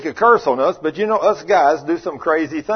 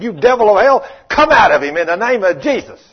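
loud rebuke of the devil; commanding shout; 5 semitones higher, 5 dB louder than usual. Retold or imitated. Preacher rebuking the devil in a boy, commanding shout. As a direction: cast the devil out with full force.

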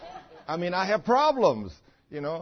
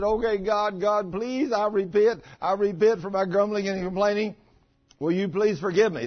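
louder, higher pleading repentance; pleading prayer; 6 semitones higher, 5 dB louder than usual. Retold or imitated. Preacher reenacting his loud plea to God. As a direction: beg God for forgiveness.

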